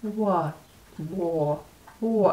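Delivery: wa- tried as "waw", halting; wa- as an American learner's "waw"; attempted British syllable. Shown as a demonstration. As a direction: try out wa- repeatedly, hunting for the rounded vowel. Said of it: "can't even get the first syllable right".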